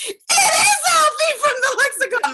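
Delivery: high, loud, laughing fan squeal; excited fan shriek. Retold or imitated. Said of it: "they're like".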